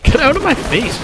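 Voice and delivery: high, loud snap at the enemy; annoyed yell. Real, not acted. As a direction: shove them off with your voice. Yelling at enemy up close.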